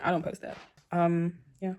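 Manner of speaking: ordinary talk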